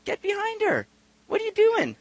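high, hushed urging; tongue-in-cheek coaxing; mock-loving encouragement. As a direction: imitate a discreet scolding in a squeezed high voice. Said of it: "I very lovingly just began to look at this guy".

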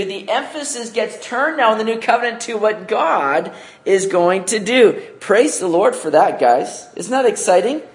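ordinary talk, nothing marked

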